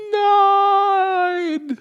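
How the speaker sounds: high, drawn-out loud Nein; anguished mock wail. Pastor acting his reaction to staying, hammed-up wail. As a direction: wail it like a cry of despair.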